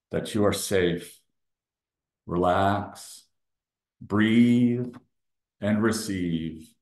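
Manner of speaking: measured meditation voice with long pauses; guided meditation. Real, not acted. Leader guides listeners to breathe and receive, meditative delivery. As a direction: guide them into breathing, one phrase at a time.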